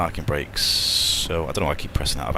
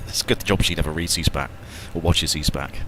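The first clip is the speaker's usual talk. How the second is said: ordinary talk, nothing marked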